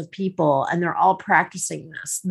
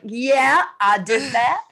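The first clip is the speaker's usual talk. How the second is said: higher, playful mock-proud voice; imitated pleased swagger; 4 semitones higher, slower than usual. Host teasing the guest who just did it, mock-proud. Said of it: "you should see her. She's like".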